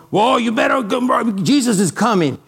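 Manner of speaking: mock fire-and-brimstone shout; fearful warning. Shown as a demonstration. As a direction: yell it like a doomsday street preacher. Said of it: "through the eyes of fear".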